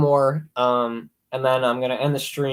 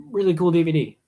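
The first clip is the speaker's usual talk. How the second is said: ordinary talk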